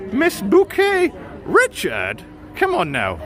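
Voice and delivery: very high, slow, prim "Bouquet"; high, drawn-out mock-posh lady voice; posh Hyacinth impression. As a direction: stretch "Bouquet" out like a snooty lady. Mimics Hyacinth saying her name.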